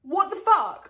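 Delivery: high, loud what the fuck; shocked outburst. Real, not acted. Reacting to the plot twist for her viewers, shocked outburst. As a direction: blurt it out in shock.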